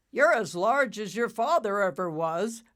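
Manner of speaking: put-on big proud bull voice greeting the young leader; bull cloud. Bull cloud tells Nimbus he's grown, booming and proud.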